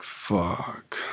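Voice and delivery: breathy whispered curse; barely voiced resigned swear. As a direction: whisper it to yourself in dread. Realizing they're going elsewhere.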